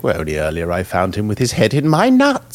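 lightly imitated posh old scholar; a sly, hammed-up quote of the line; quoting Harpocrates. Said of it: "It was like".

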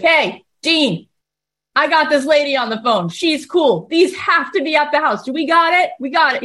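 rally the coworker; eager salesman. Retold or imitated. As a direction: mattress guy shouting to Dean, excited. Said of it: "He's like".

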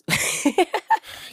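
airy high laugh. Real, not acted. Laughs after controversial point.

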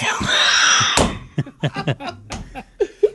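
high wheezing laugh; cracking up helplessly; burst of laughter. Real, not acted. Breaks up laughing.

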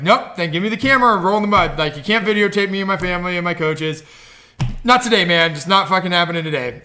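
loud, forceful telling-off; mildly heated confrontation, acted out; retold firm refusal. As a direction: lay down the law, loudly. Retold standoff with the filming teens.

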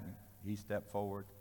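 normal talking voice

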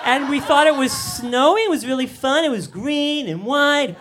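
very high, singsong imitation of a small child; little girl's voice. Daughter tells dad about flying paper, imitated high childlike voice. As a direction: play an innocent little daughter telling Daddy excitedly. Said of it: "And Sarah's like".

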